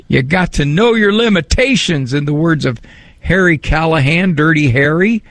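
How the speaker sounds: ordinary talk, nothing marked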